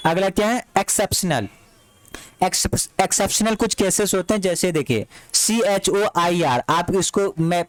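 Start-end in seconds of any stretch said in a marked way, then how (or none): none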